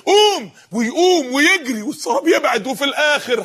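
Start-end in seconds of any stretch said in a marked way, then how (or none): 0.1-0.5 high, sharp acted call; urgent command. Urging a thirsty man to rise, insistent. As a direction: rouse him with one word.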